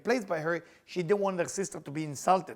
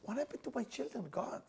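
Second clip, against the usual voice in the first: grieving plea; 7 semitones higher, 10 dB quieter than usual. Acted. Avraham pleads for his children.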